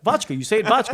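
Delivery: "vodka" insisted on as VOTCH-kuh; Mary's pronunciation. Retold or imitated. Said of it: "That's how it's pronounced".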